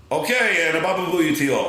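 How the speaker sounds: Howard Stern sign-off. Retold or imitated. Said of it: "I added the Howard Stern thing".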